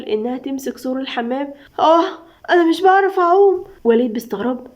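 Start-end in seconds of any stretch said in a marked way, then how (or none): 1.8-3.6 higher, louder panicked plea; panicked cry. Acted. Girl struggling in the pool.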